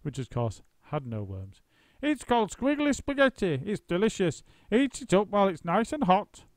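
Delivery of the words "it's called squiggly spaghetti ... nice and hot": wife faking sweetness; Missus Twit sweet. A narrator's reading. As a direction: sound innocent. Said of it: "Missus Twit said".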